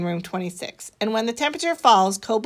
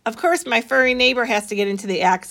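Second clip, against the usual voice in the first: ordinary delivery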